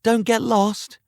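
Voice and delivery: very high-pitched spectator call; squeaky cheering onlooker; crying out. A narrator's reading. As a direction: shout a warning from the crowd. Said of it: "Another cried out".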